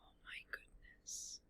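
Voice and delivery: usual speaking voice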